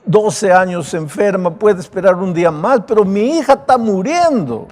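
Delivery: pleading high and loud, voice pressed forward; desperate father pleading. Acted. Jairo begs Jesus to hurry, desperate plea. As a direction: beg as a father whose daughter is dying.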